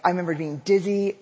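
ordinary talk, nothing marked

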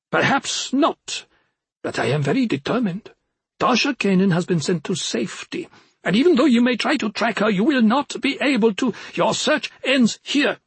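high, proud little forest imp. Acted. Imp defies witch.

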